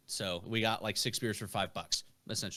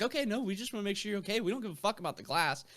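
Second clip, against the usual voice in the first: reassuring bartender; 10 semitones higher, faster than usual. Retold or imitated. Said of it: "they're like".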